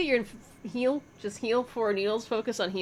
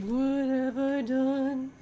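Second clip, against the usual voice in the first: drawn-out, higher wail of dismay; mock despair; 5 semitones higher, much slower than usual. Reader voicing a man falling apart in despair, mock wailing. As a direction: wail it slowly in mock anguish. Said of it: "He's like".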